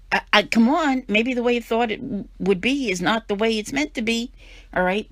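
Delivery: impassioned plea to the listener; emphatic. Real, not acted. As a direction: urge them, voice rising with conviction. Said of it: "I'm sorry if I'm getting really emphatic".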